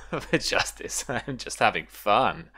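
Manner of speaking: acted laughter breaking through the words; laughing. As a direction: play him amused, laughing between phrases. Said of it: "He roars with laughter and then just says".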